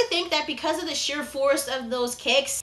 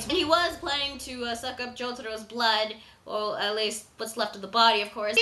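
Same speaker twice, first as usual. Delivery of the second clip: usual speaking voice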